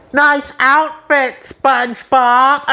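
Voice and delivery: slow, dry, sarcastic Squidward drawl; Squidward impression. Squidward mocking SpongeBob, slow and dry. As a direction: drag it out with bored sarcasm. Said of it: "Spot on acting, Roger Bumpass".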